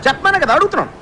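exasperated, pitch jumping up. Acted. Asking a reluctant friend to speak up, exasperated. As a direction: insist with running-out patience. Impatiently pressing a hesitant friend.

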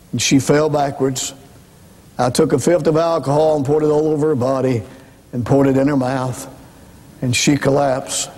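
normal talking voice

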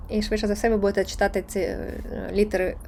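usual speaking voice

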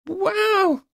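very high-pitched wow; high, impressed exclamation; amazed. A narrator's reading. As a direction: marvel in a high voice. Friend amazed by the spell.

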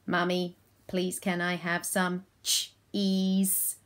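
monotone robot request, CHEESE split into ch and eese; child playing robot. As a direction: imitate a child asking in robot talk. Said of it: "my children play it with me".

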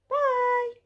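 very high squeaky Bye; cartoon monster squeaking Bye; puppet voice. Purple Monster puppet says bye.

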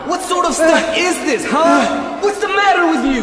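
high furious yelling at the performer; angry shout. Acted. Circus boss yelling at Bolivar, angry shout. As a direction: tear into him for the failed stunt.

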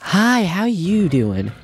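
high nervous hi to a predator; fake friendly hello. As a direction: sweet-talk the dinosaur, slowly. Greeting a raptor that appears.

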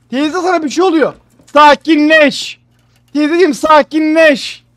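slow, loud, drawn-out calm down. Real, not acted. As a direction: shout slowly to calm her. Trying to calm the aunt.

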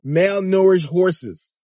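ordinary talk

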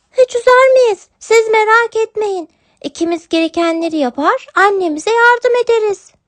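sweet kitten reassuring her father. Acted. (yavru kedi sesi, istekli)

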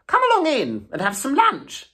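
hearty Toad. A narrator's reading. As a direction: lure them in with lunch. Toad invites them cheerfully.

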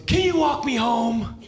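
high, slow, pleading little voice; hammed-up pitiful plea; pathetic old man. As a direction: beg like a helpless old man. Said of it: "And he goes".

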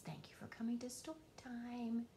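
a soft whispered goodbye. Acted. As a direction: whisper the farewell gently. Soft goodbye at story end.

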